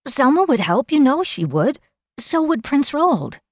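high-pitched, earnest persuasion; urging. A narrator's reading. Kel persuades Gower help exists, urging. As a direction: urge it in a high girl's voice. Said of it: "she urged".

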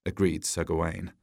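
ordinary delivery

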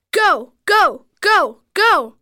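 yelled cheer. A narrator's reading. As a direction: egg him on in a contained yell. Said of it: "Mitchell and Habib yelled".

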